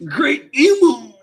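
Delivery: high, loud drawn-out mock scream; Saint Saturn screaming. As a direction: cry it out slowly as a dying plea. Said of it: "Saint Saturn is just screaming".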